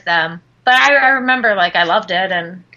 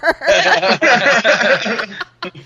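laughing; 3 semitones higher than usual. Real, not acted. Laughing at the accent.

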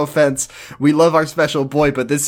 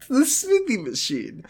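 high, soft squeak through laughter; weak from laughing, voice way up high; helpless laughter; 10 semitones higher, 5 dB quieter than usual. Real, not acted. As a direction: squeeze the words out while laughing.

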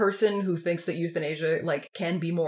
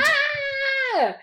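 high, loud startled cry; alarmed high yelp; 3 dB louder than usual. Acted. Acted alarm at the needle.

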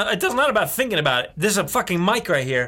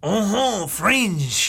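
mock French accent; 2 semitones higher, much slower than usual. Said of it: "Listen to me say".

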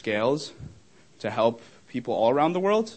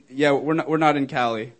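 ordinary talk

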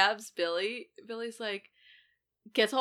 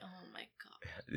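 whispered, slow gasp of horror; shocked whisper; 14 dB quieter, slower than usual. Retold or imitated. Sidney watching the boys stab each other, whispered. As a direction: breathe it out in disbelief. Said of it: "Sidney's just standing there like".

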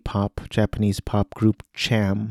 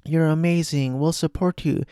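much higher, cheering fan voice; adoring fans; 7 semitones higher than usual. Retold or imitated. Fans calling out to Mima at the limousine, high adoring voice. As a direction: gush support at the idol. You can hear the fans going.

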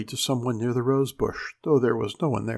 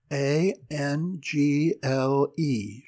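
very slow, letter by letter; spelled out; 2 semitones higher, much slower than usual. A narrator's reading. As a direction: spell it very slowly for a child below. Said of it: "she spelled down at Anna".

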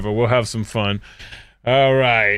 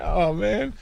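ordinary talk, nothing marked

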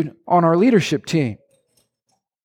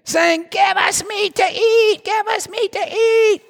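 much higher, slow nagging whine; whining crowd; 13 semitones higher, slower than usual. Acted. Moses mimicking the people whining for meat, high nagging whine. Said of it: "They keep whining to me".

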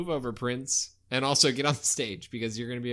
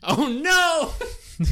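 surprised; 7 dB louder than usual. Acted. [name] brought back.

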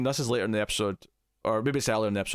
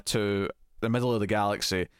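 ordinary talk, nothing marked

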